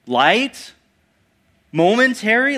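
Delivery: loud questioning echo, rising sharply; incredulous. Acted. Preacher doubting the verse for suffering listeners, loudly incredulous. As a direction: throw each word back as a disbelieving question.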